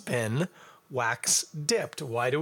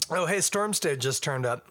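normal talking voice

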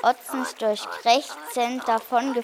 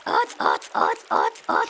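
harsh, voiceless bird croak; croaking stork. A narrator's reading. Stork calling out before he speaks, harsh repeated croaks. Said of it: "krächzte der komische Vogel erneut".

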